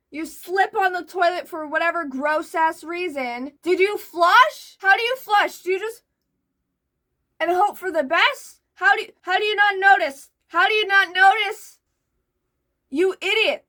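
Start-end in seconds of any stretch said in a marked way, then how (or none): 3.6-13.7 loud, high, incredulous scolding; exasperated rant. Real, not acted. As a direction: yell at him in disbelief. Ranting at poster in disbelief.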